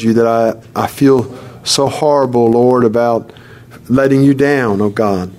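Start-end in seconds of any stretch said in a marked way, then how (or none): none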